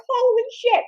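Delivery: high-pitched, stretched squeak of dismay; acted shock, way up high; shocked reaction. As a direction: react in high, horrified disbelief. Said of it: "I'm like".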